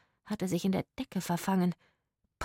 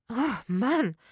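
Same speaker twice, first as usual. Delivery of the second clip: higher, fed-up sigh of words; exasperated groan; 5 semitones higher, 3 dB louder than usual. A narrator's reading. Woman fed up with her whining partner, exasperated. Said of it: "die Frau verdrehte die Augen".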